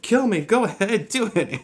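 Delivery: high, loud pleading dare; hammed-up plea to go ahead and kill him; mock desperate Bruce. Bruce begging Joe to kill him.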